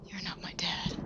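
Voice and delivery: soft, icy flat denial; boy rejecting the captain; cold whisper. Acted. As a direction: kill it with coldness. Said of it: "voice could have slaughtered".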